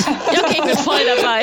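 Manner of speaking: loud, laughing agreement. Real, not acted. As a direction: give in with a laugh. (lachend zustimmen)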